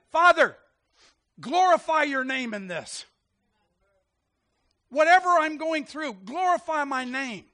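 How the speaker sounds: loud, high plea to God; ringing, declarative prayer; crying out in prayer. Acted. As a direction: call out boldly to the Father. Models a bold prayer.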